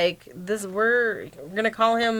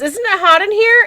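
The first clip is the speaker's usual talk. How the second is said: high voiced quote; 9 semitones higher, 3 dB louder than usual. Quoting movie character.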